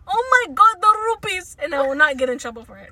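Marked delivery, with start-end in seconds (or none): 0.1-1.6 higher, louder gamer squeal; excited gamer voice. Pretend. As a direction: squeal over the loot. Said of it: "I can do".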